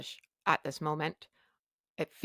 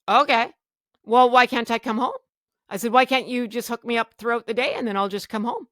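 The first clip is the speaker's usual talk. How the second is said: loud, high-pitched objection; re-enacted exasperated plea; retold pleading bargaining; 6 semitones higher, 7 dB louder than usual. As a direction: bargain insistently, voice pushed up.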